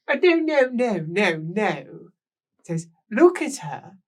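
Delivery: very high, loud refusal; loud high protest. Acted. Rouged woman refusing to be taken upstairs, high loud protest. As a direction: protest like a spoilt grande dame.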